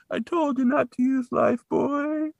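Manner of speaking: slow, high mock wail; hammed-up remorseful father wailing; melodramatic lament.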